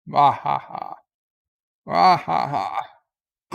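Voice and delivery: evil mock laugh. Villainous laugh after the beaming.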